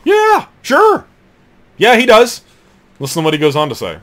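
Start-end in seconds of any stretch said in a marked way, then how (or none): 0.0-2.4 shrieked much higher and louder, cartoonish; mock squeaky yes. Preacher mimics Schlissel's careless yes to Arnson, loud high squeak.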